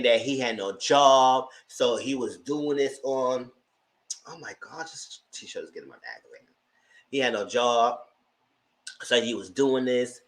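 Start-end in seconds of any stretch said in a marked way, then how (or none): 4.3-6.4 under the breath, much softer; hushed muttering about the t-shirt; whispered aside. Real, not acted. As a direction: whisper a quick aside to herself.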